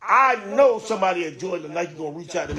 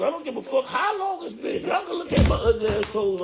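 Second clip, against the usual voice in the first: sudden high frustrated outburst, higher pitch; crashing out; 8 semitones higher than usual. Real, not acted. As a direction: burst out in real exasperation over ruined shoes. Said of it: "He's crashing out".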